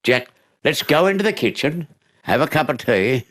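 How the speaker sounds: a hint of the homeowner's wife offering tea; kindly wife. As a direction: invite him in gently. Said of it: "So she".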